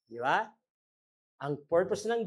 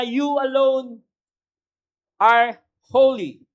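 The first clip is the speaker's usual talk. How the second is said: louder and higher, slow and weighty; proclaimed scripture; 6 semitones higher, 9 dB louder, slower than usual. Acted. Preacher booming the verse to his congregation, slow proclamation. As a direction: ring it out like a proclamation of God.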